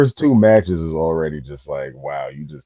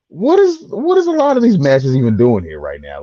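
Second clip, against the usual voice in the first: exasperated; 8 semitones higher, 6 dB louder than usual. Real, not acted. Exasperated rant at card.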